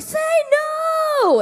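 put-on high, loud children's shout; kids shouting. Kids answering the presenter with a loud shout. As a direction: yell it out like a room of kids.

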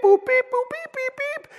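high electronic beeping; phone beeps. Retold or imitated. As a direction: chirp like a smartphone app.

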